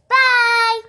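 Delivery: high, loud, drawn-out goodbye; loud, high held note; cheery sign-off. Real, not acted. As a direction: sing out a big farewell to viewers. Signing off the episode.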